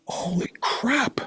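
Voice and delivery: high-pitched retold astonishment; awed realization. Newcomer's light bulb going on, acted-out amazement. Acting out his sudden realization.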